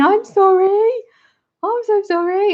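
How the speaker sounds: high, laughing mock apology from the husband; husband's laughing apology. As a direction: play the giggling husband saying sorry.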